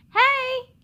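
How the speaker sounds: high, loud, happy hey; excited greeting. Acted. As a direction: greet friends eagerly. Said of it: "Lulu said excitedly".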